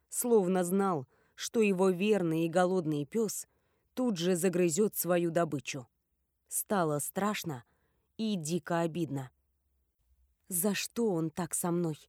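plain reading voice

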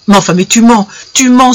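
rising, heated cry; indignant outburst; exclaimed accusation. A narrator's reading. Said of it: "s'écria-t-il".

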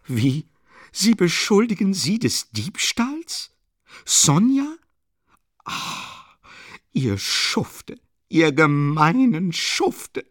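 high, loud indignation with drawn-out words; outraged cries. A narrator's reading. Katerina rushing at Luschin over the accusation, outraged. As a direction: cry out in outrage, breaking for a breathy gasp. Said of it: "rief plötzlich Katerina Iwanowna".

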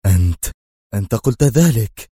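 shocked cry; astonished exclamation. A narrator's reading. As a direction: blurt it in disbelief. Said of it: "هتف توماس في دهشة".

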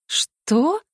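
high, rising astonished question; boundless surprise. A narrator's reading. Larisa stunned by her sister's accusation, utter astonishment. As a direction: ask it in utter disbelief. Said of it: "с безграничным удивлением переспросила Лариса".

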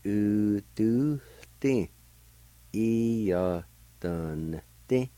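both parts in drawn-out, separated syllables. Shown as a demonstration. Teacher putting both parts together, syllable by syllable. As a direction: sound out u, du, ti, E, ya, dun, ti piece by piece. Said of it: "And together".

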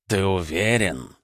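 put-on slow creaking old voice; creaky voice. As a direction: creak like an unoiled cart. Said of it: "голос алтаря скрипел хуже несмазанной телеги".

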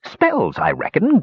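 plain reading voice

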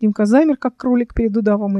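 ordinary delivery

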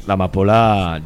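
usual speaking voice

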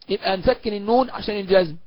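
usual speaking voice

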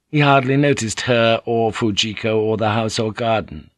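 ordinary talk

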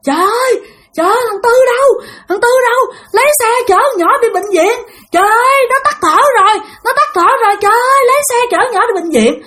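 very high, panicked grandmother's cry; crying for help. Retold or imitated. Grandmother calling neighbours to rush child to hospital, crying for help. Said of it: "Nghe tiếng ngoại bạn la làng".

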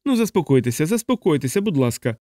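ordinary delivery

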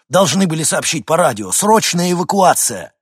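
loud, high-pitched warning; urgent alarm. Acted. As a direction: press the warning home loudly. Мужчина предупреждает о наводнении. (громко, с тревогой)

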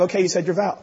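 ordinary delivery

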